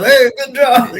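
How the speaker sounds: bright high cheery "good job", played for laughs; mock pal's praise. Bloodied wrestler hoping Pondo approves, high mock praise. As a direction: toss it off like a buddy clapping your back.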